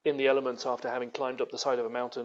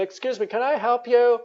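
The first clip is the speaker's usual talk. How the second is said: higher, louder, politely suspicious office worker; 9 semitones higher, 6 dB louder than usual. Retold or imitated. Office worker approaching a stranger in the parking lot, bright and polite. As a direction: ask it brightly, as a wary but polite employee.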